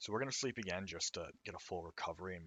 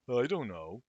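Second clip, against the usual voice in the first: loud snapping reply; 5 semitones higher, 8 dB louder than usual. Acted. Sakuya snaps back louder.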